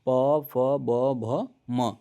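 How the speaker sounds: "प, फ, ब" said very slowly, each lip sound pressed out and separate; teacher slowly sounding "प, फ, ब" to show both lips closing; slow, lips-pressed letters. Shown as a demonstration. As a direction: spell out प, फ, ब slowly, lips shut firmly on each. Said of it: "दोनों ओठ आपका चिपक रहा होगा".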